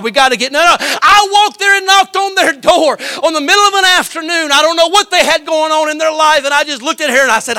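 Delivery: high, loud revival preaching; full-force preaching. Acted. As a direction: lift the whole church with it.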